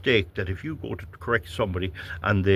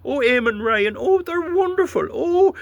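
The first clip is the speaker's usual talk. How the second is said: much higher, louder gushing falsetto; mocking green enthusiasm; 4 dB louder than usual. Mocking politicians praising electric cars, swooning falsetto. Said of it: "Keir Starmer was".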